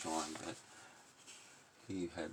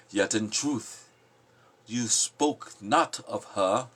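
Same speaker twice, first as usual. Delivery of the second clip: louder, slow, ringing recital; solemn poem declaiming; 5 semitones higher, 9 dB louder, slower than usual. Acted. The prophet answering the people, solemn loud declaiming. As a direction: proclaim it like a prophet's sermon.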